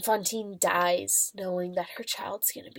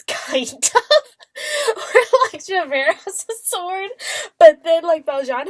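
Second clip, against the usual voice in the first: laughing through the words, high-pitched; real laughter mid-sentence; 10 semitones higher, 9 dB louder, slower than usual. Real, not acted. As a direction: crack up at how silly it was. Laughing at the movie sword fight.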